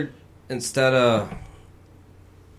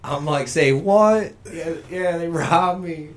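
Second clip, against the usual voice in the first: mock panicked friend; 8 semitones higher, 8 dB louder than usual. Voicing his friend's panicked phone call.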